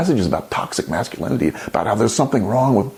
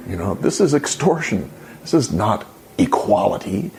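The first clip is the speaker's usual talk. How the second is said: ordinary talk, nothing marked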